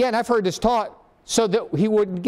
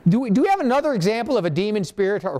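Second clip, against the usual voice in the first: ordinary delivery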